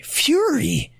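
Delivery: can't believe the headline says fury; sharp rising-then-falling astonishment; incredulous surprise. Real, not acted. Reacts to a headline.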